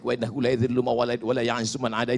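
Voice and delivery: fast, low, soft Arabic rattle; rapid recitation. Shown as a demonstration. Preacher reciting the remaining praise to Allah, quick low recitation. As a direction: rush through the rest of the prayer under the voice. Races through rest of prayer.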